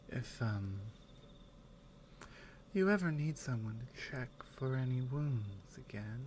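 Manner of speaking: soft, low, gentle companion talking close; caring companion. Acted. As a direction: take care of the traveller softly, half-whispered at times. Soft in-character roleplay to listener.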